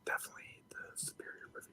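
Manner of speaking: whispering. Pretend. Whispering bit about A Quiet Place.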